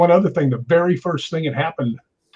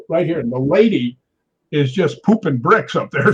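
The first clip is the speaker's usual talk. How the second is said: usual speaking voice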